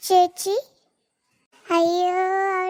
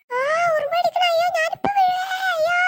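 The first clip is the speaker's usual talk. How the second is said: frightened yelp before a fall; panicked crying shout; 8 semitones higher than usual. Acted. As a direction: cry out as you lose your grip. Ant bites, slipping from tree.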